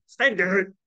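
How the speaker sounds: misplaced weight on the end of "standard"; wrong stress. Shown as a demonstration. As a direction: push up the "dard" of "standard". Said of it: "可不可以念standard".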